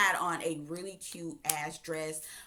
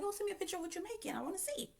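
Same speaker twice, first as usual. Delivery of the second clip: high, quick, soft coaxing ask; breezy high-voiced imitation of herself asking for a photo; sweet ask; 12 semitones higher, 10 dB quieter, faster than usual. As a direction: coo it like a flirty request. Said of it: "and I was like".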